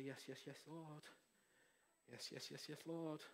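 soft prayerful murmur; hushed, reverent repeated yes; quiet surrender. Shown as a demonstration. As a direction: murmur your assent to God, very gently. Models a surrendered response to God.

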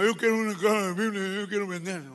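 muffled nasal speech impediment; gangoso nasal voice. Acted. Nasal-voiced man asks the pastor for Bibles, gangoso. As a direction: talk it all through the nose. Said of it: "Sí, escuchó del gangoso".

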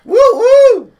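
high loud whooping; excited cheer. Real, not acted. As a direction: whoop like a crowd. Cheering the intro.

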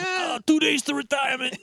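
high shouted cliché line; hammy dying cop. Retold or imitated. Parody death scene.

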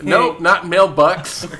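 loud, sharp, slowed-down correction; yelling. Real, not acted. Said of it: "You're getting louder and louder".

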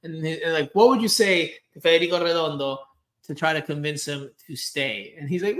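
ordinary talk

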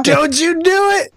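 high mock-Texan warning; thick Texas accent. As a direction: join in as a huffy Texan scolding. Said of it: "extremely thick Texas accents".

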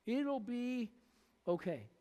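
calm, a bit patronizing. Acted. Preacher voicing the Lord answering anxious Martha, soft and patronizing. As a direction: hush her gently like a fretting child. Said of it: "And the Lord said".

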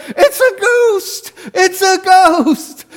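high, slow hammed-up wail; mock ghost scream. Disciples on the boat screaming at Jesus, hammed-up and high. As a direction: wail it like terrified fishermen. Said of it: "they start screaming and wailing".

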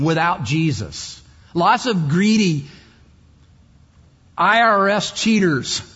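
loud, driving sermon voice; full-force preaching. Acted. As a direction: hammer each line home to the crowd.

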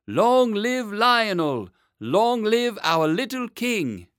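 loud, slow drawn-out cheer; crowd shouting. A narrator's reading. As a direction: hail the new king like a cheering crowd. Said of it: "thousands of people shouting".

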